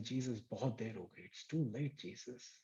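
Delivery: hushed resignation. Acted. Grieving sister tells Jesus it is too late, hushed resignation. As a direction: let the words sink away, quietly resigned. Mary's hopeless words to Jesus, acted.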